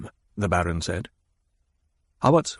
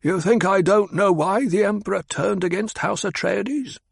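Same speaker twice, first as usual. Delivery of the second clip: higher, defiant question; Hawat, challenging; 10 semitones higher than usual. Acted. Hawat defies the Baron, higher. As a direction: throw it back at him.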